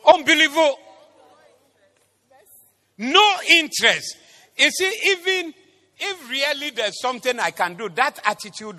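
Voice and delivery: high, loud, exasperated pulpit delivery; loud preaching exclamation. Real, not acted. Preacher decrying a listener's lack of interest, loud exasperated preaching. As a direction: exclaim it loudly at the congregation.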